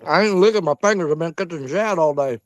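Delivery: normal talking voice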